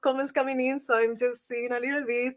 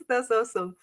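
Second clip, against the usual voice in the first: usual speaking voice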